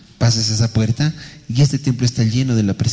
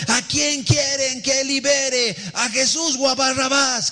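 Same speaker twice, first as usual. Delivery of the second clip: high, drawn-out calling voice without extra volume; crowd-addressing cry; 11 semitones higher, slower than usual. Acted. Pastor re-enacting the question put to the crowd, high drawn-out cry. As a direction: call it out slowly to a whole multitude. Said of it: "cuando la gente gritó".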